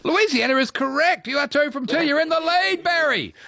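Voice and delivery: high-pitched, jubilant announcement; excited quiz host. Real, not acted. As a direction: celebrate the contestant's correct answer at high pitch. Quiz host announces a correct answer.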